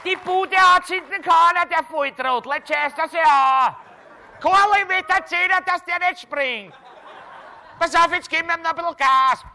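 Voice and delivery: high, loud mocking Viennese neighbour voice; jeering neighbours. Neighbours across the street heckling the man on the sill, high loud jeering. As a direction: jeer at the coward on the sill.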